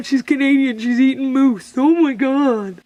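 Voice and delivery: mocking voice. Mock outsider voice teasing her.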